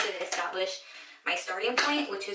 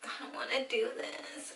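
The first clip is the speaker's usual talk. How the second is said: soft, whispery, close to tears; tearful reluctance; 4 semitones higher, 8 dB quieter than usual. Real, not acted. Reluctant to cut her hair, soft tearful whisper. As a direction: say it under your breath, dreading it. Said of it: "I'm gonna cry".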